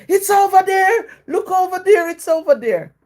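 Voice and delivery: high-pitched imitation of a shouting shop worker; retold shout. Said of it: "she shouted at me".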